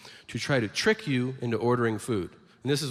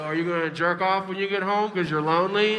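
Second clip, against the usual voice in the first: higher put-on voice, taunting; mock taunting worker; 5 semitones higher, 3 dB louder than usual. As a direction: tease the lonely customer.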